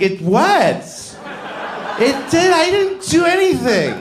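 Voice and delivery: high sing-song denial with laughter; defensive America. Acted. America as girlfriend, shrill high defensive denial. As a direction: play America as a guilty girlfriend.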